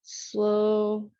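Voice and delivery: ordinary talk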